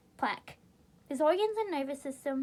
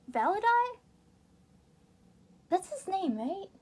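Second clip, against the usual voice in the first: questioning rise, higher; puzzled; 6 semitones higher than usual. Real, not acted. Reader doubting the character name, puzzled. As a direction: say the name unsure.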